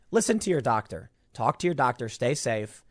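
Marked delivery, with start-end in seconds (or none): none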